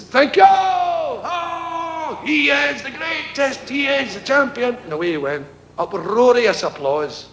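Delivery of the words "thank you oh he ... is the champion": high, slow hammed-up cheering; cheering crowd. Audience cheering the tamer's exit, high mock adulation. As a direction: hail him as the champion.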